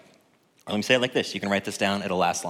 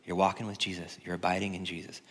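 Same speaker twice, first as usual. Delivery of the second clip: ordinary talk, nothing marked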